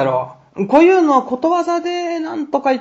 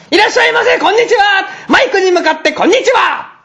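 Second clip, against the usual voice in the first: high-pitched shout; 9 semitones higher than usual. Retold or imitated. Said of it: "って思いっきり叫んでしまってね". (笑いながら甲高く叫ぶ)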